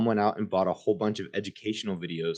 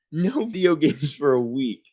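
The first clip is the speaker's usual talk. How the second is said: high, louder, slow mom voice with a laugh; gleeful mom; 7 semitones higher, 7 dB louder, slower than usual. Acted. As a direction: deliver the sentence with relish. Mom announces punishment.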